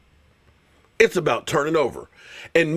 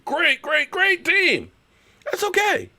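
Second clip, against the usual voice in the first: high falsetto mock gushing; singsong, high-pitched mockery; sarcastic praise; 12 semitones higher, slower than usual. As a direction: squeal fake admiration for the rivals. Mock praise of rival team.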